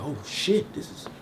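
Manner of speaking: hushed, alarmed imitation of a worried woman; panicked woman, mock. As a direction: mutter it like she just realised. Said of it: "a lot of women that was like".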